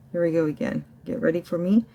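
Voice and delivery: ordinary talk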